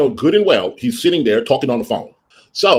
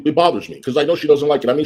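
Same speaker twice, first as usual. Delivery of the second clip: ordinary talk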